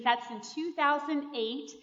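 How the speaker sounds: usual speaking voice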